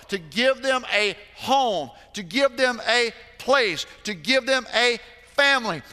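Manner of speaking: loud, high preaching at full force; fervent pulpit preaching. Acted. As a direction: preach it out to the congregation.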